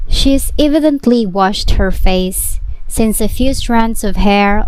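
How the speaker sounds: plain reading voice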